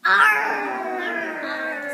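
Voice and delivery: big loud high pirate growl; pirate arr. Shown as a demonstration. Reader shows the kids her favorite pirate word, loud pirate roar. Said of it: "but my favorite is".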